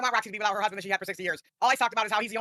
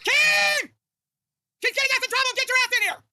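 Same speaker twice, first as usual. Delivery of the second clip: much higher, louder yell for Keem; calling out; 5 dB louder, slower than usual. Real, not acted. [name] yelling for Keem to come in, loud and high. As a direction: call Keem in at full voice.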